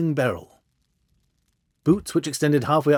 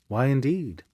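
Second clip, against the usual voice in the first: ordinary delivery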